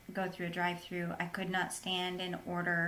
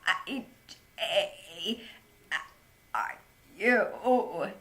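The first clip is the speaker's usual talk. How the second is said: choked, broken-off words forced out louder; strained, halting voice cutting out between words; mimicking spasmodic dysphonia; 5 dB louder, much slower than usual. Shown as a demonstration. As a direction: squeeze each word out through a spasming throat. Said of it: "it would come out something like this".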